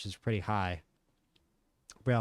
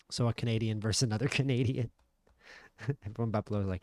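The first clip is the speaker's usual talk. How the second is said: usual speaking voice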